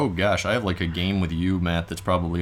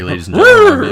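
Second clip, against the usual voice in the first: ladies and gentlemen belted out much higher and louder, showman style; mock announcer; 16 dB louder than usual. Host jokingly addresses the audience as announcer with a big booming mock-announcer voice. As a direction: announce it to the crowd like a ringmaster, hammed up.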